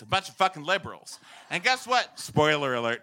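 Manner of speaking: ordinary talk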